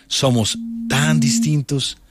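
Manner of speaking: slow, drawn-out title line read like a promo; dramatic slogan. Acted. Host announcing his section's motto, slowly and solemnly. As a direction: let each phrase hang, slowly.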